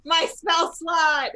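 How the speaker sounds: high, drawn-out wail; lamenting cry. Real, not acted. Player mourning a wasted spell slot, drawn-out lament. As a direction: cry out slowly over the loss.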